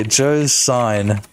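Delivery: normal talking voice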